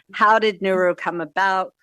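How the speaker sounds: usual speaking voice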